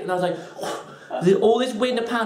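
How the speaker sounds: ordinary delivery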